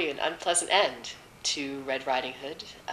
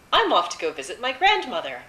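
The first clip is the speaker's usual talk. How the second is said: lightly voiced naive girl, pitched up; a touch louder and higher, innocently trusting; 5 semitones higher, 4 dB louder than usual. A narrator's reading. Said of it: "sort of foolish and a little naive".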